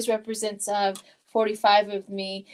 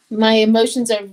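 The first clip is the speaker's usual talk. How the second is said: ordinary delivery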